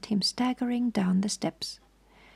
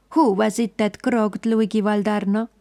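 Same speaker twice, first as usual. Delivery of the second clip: louder, rough gangster voice; hoarse Italian; 5 dB louder than usual. A narrator's reading. The Italian menaces them all, louder and rough. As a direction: growl it with the gun raised. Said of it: "asked the Italian hoarsely".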